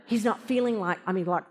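usual speaking voice

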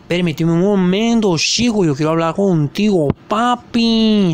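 personaje con acento marcado, en su registro más contenido; Santino. Acted. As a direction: habla con acento exagerado, alargando el final. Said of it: "acento tan marcado". (voz de luchador con acento caricaturesco)

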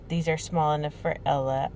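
quiet, voice cracking into tears; fighting back tears as the words land; choked up. Real, not acted. Reading her friend's thank-you card, voice breaking into tears.

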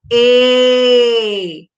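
loud, long "E"; held vowel E. Shown as a demonstration. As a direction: call out the letter "E" for the class. Teacher models vowel sound.

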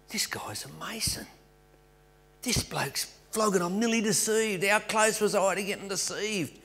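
suddenly sure he is being tricked; alarmed realisation. Retold or imitated.

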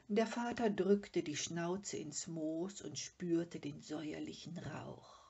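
ordinary delivery, nothing marked

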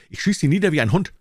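high, loud threat; threatening shout. A narrator's reading. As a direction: spit the threat out in panic. Threatening with a revolver.

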